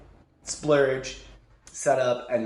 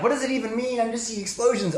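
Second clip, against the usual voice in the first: bewildered; 7 semitones higher, faster than usual. Retold or imitated. Confused amid battle.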